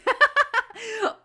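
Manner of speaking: loud, high-pitched giggle; amused laugh. Real, not acted. Reactor joking about the comedian's same suit, amused laugh.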